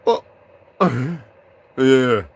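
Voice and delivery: ordinary talk, nothing marked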